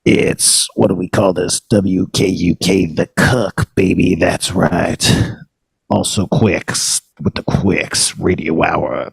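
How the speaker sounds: slow, low-pitched radio host patter; radio show intro. Acted. Host welcomes listeners to the spleen's radio show, slow announcer patter.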